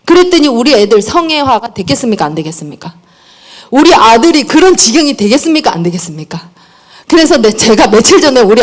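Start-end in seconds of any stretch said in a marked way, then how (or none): 2.2-2.9 low aside. Real, not acted. Rhetorical answer to own question. 5.7-6.5 low aside. Real, not acted. Repeated rhetorical answer.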